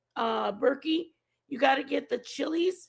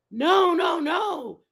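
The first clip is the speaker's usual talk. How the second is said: alarmed no; 5 semitones higher, 6 dB louder, much slower than usual. Retold or imitated. Mom panics at wrong picture.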